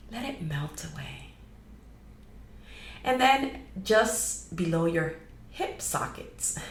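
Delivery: measured guiding voice with long pauses; guided meditation. Acted. Host guiding listeners to release energetic clutter, guided meditation. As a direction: lead them unhurriedly through a grounding meditation.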